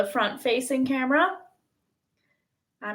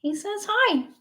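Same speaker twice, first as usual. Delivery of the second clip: much higher cutesy pet voice; cat voice; 10 semitones higher than usual. Pretend. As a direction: speak for the cat, high and cute. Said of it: "annoying cat voice".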